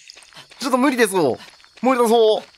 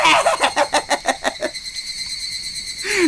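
high, breathy laughing shriek rising up; helpless laughing yell; 8 semitones higher than usual. Real, not acted. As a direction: burst out in disbelieving laughter. Breaks into shouting laughter.

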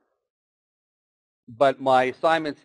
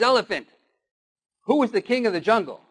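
higher, lightly voiced bossy lion; demanding lion; 9 semitones higher than usual. Lion demanding the elephant name the king, higher and bossy. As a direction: puff up like a lion who wants to hear he's king.

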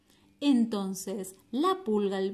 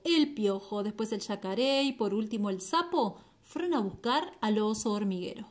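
usual speaking voice

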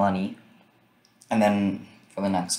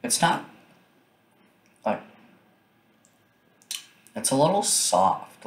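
muffled closed-mouth speech; chewing while talking; 5 semitones higher than usual. Real, not acted. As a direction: mouth partly full. Seen 0: Mouth stays closed mid-speech; holds a chocolate piece; bite likely just before.

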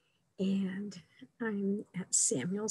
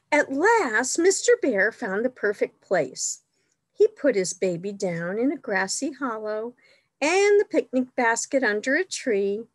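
delighted exclamation; 2 semitones higher, 4 dB louder than usual. A narrator's reading. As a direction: exclaim it brightly as a storybook bear. Reads picture book to children.

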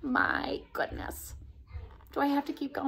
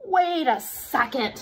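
usual speaking voice

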